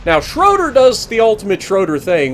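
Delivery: ordinary delivery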